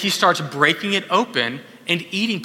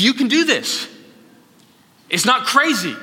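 imitated coaxing, pitched well up; high, insistent encouragement; high-pitched urging; 7 semitones higher than usual. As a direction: coax a hesitant friend in a higher voice. Voicing someone urging him on.

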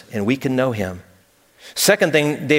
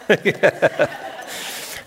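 soft breathy laugh; 2 semitones higher, 4 dB quieter than usual. Real, not acted. Laughter after joke.

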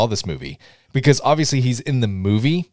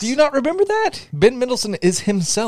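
high, incredulous do you not remember; disbelieving exclaim. Real, not acted. Friend can't believe the other missed it, high and incredulous.

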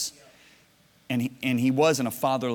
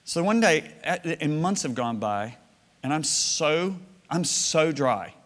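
ordinary delivery

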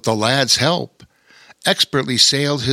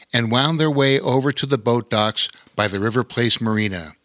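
usual speaking voice

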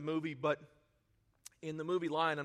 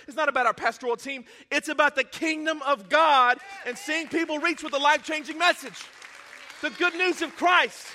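rising, louder preacher's exhortation building to a cry; preaching at full force; 6 semitones higher, 7 dB louder than usual. Acted. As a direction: build the sermon to a loud climax.

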